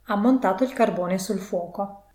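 ordinary delivery

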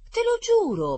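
high, loud heartfelt oath; protesting. A narrator's reading. Rita assuring there is enough cake, protesting. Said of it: "protestò Rita".

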